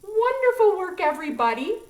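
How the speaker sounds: high, loud excited praise; big praise. Real, not acted. Praising the singing children.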